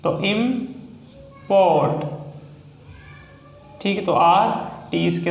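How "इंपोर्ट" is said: Import split into "im", long pause, "port"; sounded out slowly. Shown as a demonstration. Teacher breaking down a shorthand example, sounded out slowly. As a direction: say import in two separated halves.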